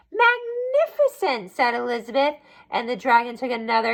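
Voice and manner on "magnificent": high, slow, gushing; gushing praise. Acted. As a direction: lay the praise on thick. Elizabeth flatters.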